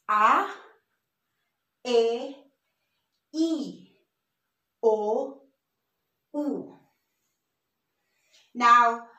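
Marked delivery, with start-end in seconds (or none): 0.1-6.8 A, E, I, O, U as ah, eh, ee, oh, oo; Spanish vowel sounds. Shown as a demonstration. Teacher reviews the vowels for learners, spaced Spanish sounds. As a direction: isolate each pure vowel with a long pause between. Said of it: "So again, this is".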